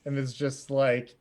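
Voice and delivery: ordinary talk, nothing marked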